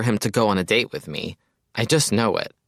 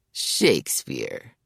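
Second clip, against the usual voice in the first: low, slow, dreamy name-drop; swooning reverence; 7 semitones lower, much slower than usual. Acted. Mayers swooning as he names his idol, slow and low. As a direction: sigh the name like a lover. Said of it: "Mayers practically swoons".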